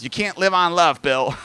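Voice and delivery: mock grandma retort; exasperated grandma. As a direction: object bluntly like a fed-up wife. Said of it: "And my grandma goes".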